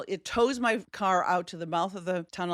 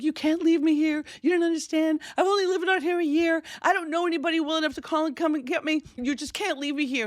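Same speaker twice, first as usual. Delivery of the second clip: desperate begging; 10 semitones higher than usual. Retold or imitated. To the garage man on the phone, frantic begging. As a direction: beg like you're being abandoned. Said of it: "So I freak out and I beg".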